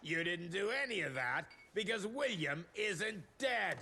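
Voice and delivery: ordinary delivery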